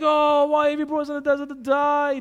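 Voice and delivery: high, loud mock whine; whinging. People complaining in the desert, high mock whinging. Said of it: "they're whinging already".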